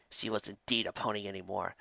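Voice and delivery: usual speaking voice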